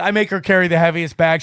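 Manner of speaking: ordinary talk, nothing marked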